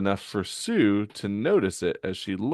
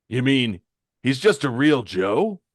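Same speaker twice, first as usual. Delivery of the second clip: raised, incredulous question; louder, taken aback; surprised question; 4 semitones higher, 5 dB louder than usual. Acted. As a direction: blurt it in disbelief. Hero surprised by the news.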